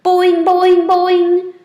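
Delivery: high, loud twanging frog call; twanged boing. A narrator's reading. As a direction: twang each boing like a rubber band. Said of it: "until Og twanged".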